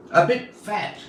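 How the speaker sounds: ordinary delivery, nothing marked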